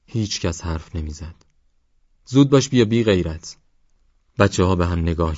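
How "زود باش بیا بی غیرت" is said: loud, higher barked order; father calling his son away in anger; angry command. Acted. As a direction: order him over, fuming.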